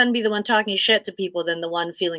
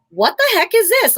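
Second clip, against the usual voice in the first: high, loud startled reaction; quick-reacting parent spotting something, high loud surprise; mock outburst; 9 semitones higher, 6 dB louder than usual. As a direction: snap at it in alarm, pitched up.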